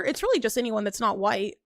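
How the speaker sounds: usual speaking voice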